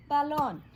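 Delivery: \"balón\" dito alto e claro, com acento no fim; model word. Shown as a demonstration. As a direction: call out \"balón\" for the class to repeat. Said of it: "So everybody say".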